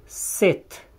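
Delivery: sit with a crisp S, whispered; English sit. Shown as a demonstration. Teacher modelling English sit, crisp S. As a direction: say sit with a Romanian-style S. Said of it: "ca un S românesc".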